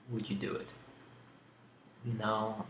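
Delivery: ordinary talk, nothing marked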